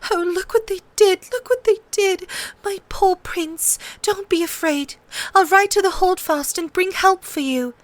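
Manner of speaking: high, tearful pleading tone. A narrator's reading. Sansa tearfully comforts the wounded prince. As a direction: comfort him through tears. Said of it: "she sobbed".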